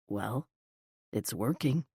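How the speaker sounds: low, soft and deep, almost murmured. A narrator's reading. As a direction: drop low and quiet, a flirty rumble. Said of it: "he said in that deep voice".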